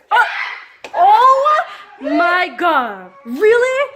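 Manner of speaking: high, loud, drawn-out wail; laughing, half-crying protest; dismayed outcry. Real, not acted. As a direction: wail in disbelief at losing power. Power has just gone out.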